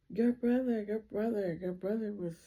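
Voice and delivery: high, halting distraught stammer; retold hysteria. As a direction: imitate a frantic caller struggling to get it out. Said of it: "like, hysterically".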